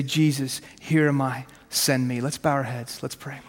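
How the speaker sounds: ordinary talk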